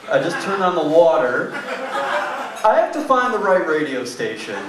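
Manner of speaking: leisurely dad taking his time; drawn-out answer. Retold or imitated. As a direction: stretch every word out unhurried. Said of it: "long, drawn-out answer".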